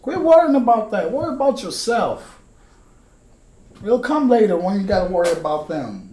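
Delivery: high, loud parental advice voice; mock-scolding her kids to stop worrying; advising her kids. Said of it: "That's why I tell my kids".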